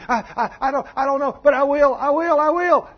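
high, loud, rising desperate promise; panicked boy pleading. Retold or imitated. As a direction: beg in a squealing kid voice. Pinched boy begging promise.

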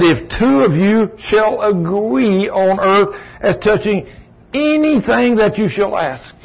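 high and slow, each word weighted; slow, emphatic scripture quote. Acted. Preacher to congregation, slow emphatic high quote. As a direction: drive the promise home slowly. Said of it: "slow emphatic scripture quote".

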